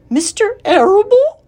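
high, loud tearful disbelief; upset, sobbing squeal; sobbing question. Acted. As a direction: wail it as a stricken question. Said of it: "sobbed Wilbur".

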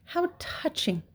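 high, softened fake sympathy; sarcastic rat coo; mocking. A narrator's reading. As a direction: sneer it with mock tenderness. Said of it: "he mimicked".

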